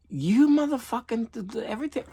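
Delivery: high-pitched imitation of a cursing neighbor; mock angry neighbor. Neighbor storming over about the stray cat, high mock rant. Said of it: "but just like".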